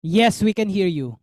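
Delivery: loud and clear for a bad line. Real, not acted. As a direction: call out so they hear you. Repeating loudly over a bad line.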